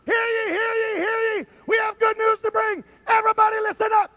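high ringing crier's shout; town crier. Acted.

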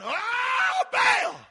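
high, wailing shout to an idol; hammed-up worshippers crying out; mock frantic cry. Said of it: "They're jumping".

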